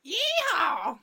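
loud, high mock Texan holler; cowboy whoop. Co-host interrupting at the mention of Texas, cowboy whoop. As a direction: let out a hammed-up rodeo whoop.

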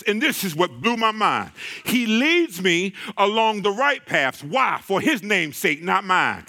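ordinary talk, nothing marked

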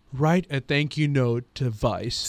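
low, soft, slow pronouncement; slow hushed mock-wisdom; imitated sage dad. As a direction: lean back and deliver it gravely, as someone's father. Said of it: "sits back and he goes".

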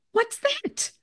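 high, alarmed what's that; a sudden high-pitched demand; startled question. Retold or imitated. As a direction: ask sharply high in alarm.

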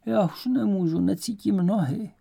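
high-pitched, worn-out complaint; wailing lament. A narrator's reading. Exhausted Myška with numb feet complains on the march, wailing. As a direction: wail it as if you cannot go on. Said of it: "běduje Myška".

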